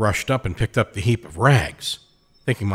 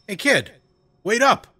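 put-on high-pitched call after a kid; yelled call; 13 semitones higher than usual. A narrator's reading. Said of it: "I yelled".

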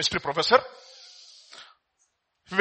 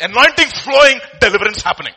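much higher, much louder excited cry; 7 semitones higher, 12 dB louder than usual. Real, not acted. Preacher excitedly describing deliverance to the congregation. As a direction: burst out with the wonder of it.

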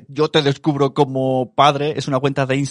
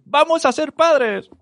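excited announcement; slower than usual. Retold or imitated. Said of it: "que te dijeron".

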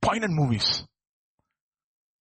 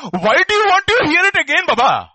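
much higher, louder, fed-up retort; exasperated outburst; 13 semitones higher, 10 dB louder than usual. Retold or imitated. Healed man losing patience with Pharisees, loud and high. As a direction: snap back losing patience. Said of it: "this guy is getting exasperated".

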